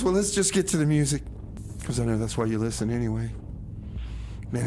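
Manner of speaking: ordinary talk, nothing marked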